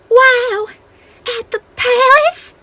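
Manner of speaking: very high, loud excited cry; fairy thrilled by the party. Acted. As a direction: burst out in delight. Fairies thrilled.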